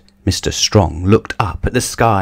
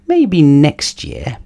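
slow, higher-pitched pondering; musing to oneself; 8 semitones higher, much slower than usual. A narrator's reading. Mister Strong in his armchair, musing to himself. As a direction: draw it out, thinking aloud. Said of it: "he said to himself".

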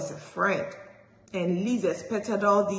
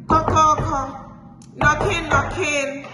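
knocking sounds; 8 semitones higher, 6 dB louder than usual. A narrator's reading. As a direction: knock at the door with your voice. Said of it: "it was like".

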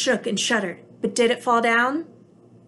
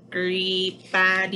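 sneaky robot creep; 2 semitones lower, slower than usual. Acted.

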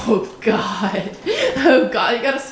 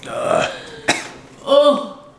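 gagging sounds, nauseous; retching and groaning; dry heaving; much slower than usual. Real, not acted. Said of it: "I feel like I'm gonna throw up".

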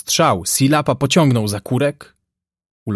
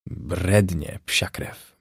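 low, soft grumble; angry mutter; 4 semitones lower, 6 dB quieter, much slower than usual. A narrator's reading. Yankee lowers his rifle butt, muttering angrily. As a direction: mutter the curse under your breath. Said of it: "mruknął ze złością Jankes".

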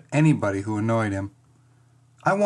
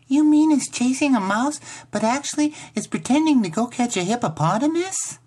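put-on high-pitched small girl, full of wonder; wide-eyed little sister; 12 semitones higher than usual. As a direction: ask it like an amazed six-year-old. Voices six-year-old little sister.

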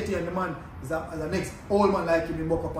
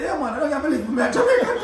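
excited laughing exclaim; 10 semitones higher, 6 dB louder than usual. Retold or imitated. Excited reply on meeting countryman.

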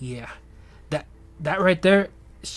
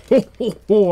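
loud, raised ho-ho-ho; Santa belly laugh; 6 semitones higher, 10 dB louder than usual. Acted. Santa gloating over a stolen card, jolly boom. Playing Santa in a little story.

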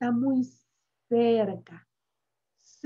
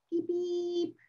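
light toy horn beeps; car horn beep; 5 semitones higher, 6 dB quieter than usual. Acted.

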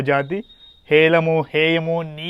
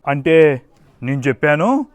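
usual speaking voice

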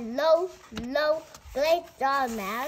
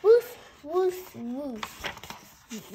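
dog barks; much slower than usual. Pretend. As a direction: bark like the puppy in the book. Dog barking in picture book.

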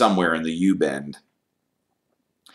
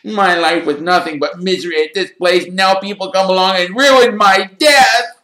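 high wail building louder; howling; 12 semitones higher, 6 dB louder than usual. A narrator's reading. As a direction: howl your misery, swelling to the end. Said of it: "howled Myrtle".

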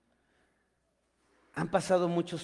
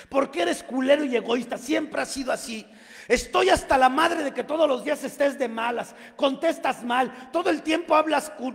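much higher and louder, building to a shout; mother screaming every grievance at her son; furious shouting mother; 9 semitones higher, 8 dB louder than usual. Retold or imitated. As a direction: let it explode at him. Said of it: "se fue bien recio".

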